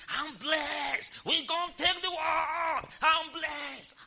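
put-on old preacher on tape, shouting. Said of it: "he was shouting".

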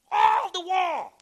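loud, high, drawn-out burst; preacher's exultant shout. Real, not acted. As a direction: shout it slowly to the whole congregation.

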